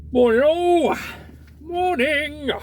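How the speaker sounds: sing-song greeting. Real, not acted. Cheery greeting calling out to viewers.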